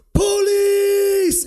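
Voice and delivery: high, loud desperate yell; screamed for police. Retold or imitated. Scaring off the guys at his door, loud high scream. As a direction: scream it to scare the intruders off. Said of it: "I just screamed".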